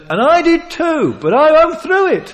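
high, loud, earnest admission; voiced confession. Peter admitting his past to the crowd, high and loud. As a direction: own it proudly.

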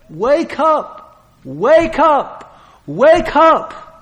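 high, building wake-up shouts; urgent call. Acted. God rousing Peter through the rooster, high building shout.

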